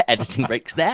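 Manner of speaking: high, loud words through real laughter; cracking up while talking; laughing. Real, not acted. Friends laughing at his explanation.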